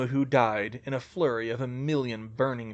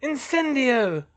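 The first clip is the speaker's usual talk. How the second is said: high, slow, drawn-out incantation; raised, stretched-out spell cry; casting a spell; 11 semitones higher, 4 dB louder, slower than usual. Acted. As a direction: cast it with villainous force. Villain casts fire spell.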